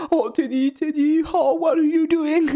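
high, slow tender coo; wife hugging the living cat, a high slow coo. Retold or imitated. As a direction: coo over the cat lovingly. Wife cooing to found cat.

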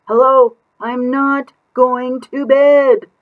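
put-on sing-song kid refusal; cheeky child.

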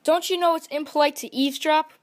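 high, quick reproach; startled scolding. A narrator's reading. Chameleon scolding the listening crab, indignant. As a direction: scold the eavesdropper. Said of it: "said the startled chameleon".

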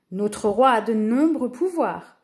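ordinary delivery

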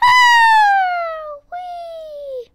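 high, loud thrilled squeal on the ride; excited squeal. Real, not acted. Kid on the ride squealing with joy. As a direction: let it fly out in delight. Riding down the slide.

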